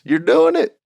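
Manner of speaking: high, lightly voiced Texan mentor, casual; Texas boy. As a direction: toss it off as a breezy Texas good ol' boy. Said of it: "good old Texas boy".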